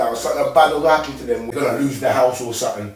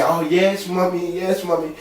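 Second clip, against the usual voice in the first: lightly imitated child, pitched up; a hint of a child agreeing with mummy; obedient child; 5 semitones higher than usual. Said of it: "as your kid and that, you say".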